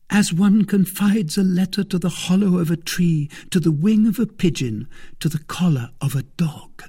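a hint of a young wife mocking her husband; scornful young woman. A narrator's reading.

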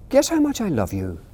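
put-on a small child testing his parent; little hare asking. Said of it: "he said".

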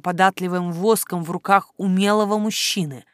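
ordinary delivery, nothing marked

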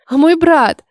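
put-on loud, high girlish protest; sudden exclamation. A narrator's reading. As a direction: cry out in alarm for your brother. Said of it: "воскликнула Грета".

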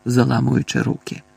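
ordinary delivery, nothing marked

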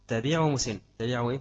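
ordinary talk, nothing marked

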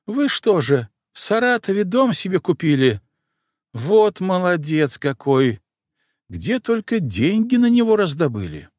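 put-on high, amazed old housekeeper; gushing with pride and wonder; excited old woman. A narrator's reading.

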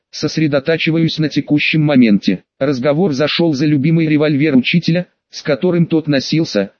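usual speaking voice

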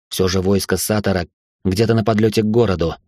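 ordinary delivery, nothing marked

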